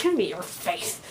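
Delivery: growled mock-menacing movie line; Optimus Prime. Vlogger venting through a movie quote, mock-menacing growl. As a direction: growl it like a battling robot hero. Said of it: "like Optimus Prime in Revenge of the Fallen".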